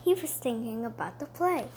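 usual speaking voice

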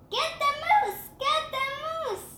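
high yelled get the moose; hunters' call. Acted. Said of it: "David heard a voice call".

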